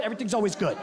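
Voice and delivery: ordinary talk, nothing marked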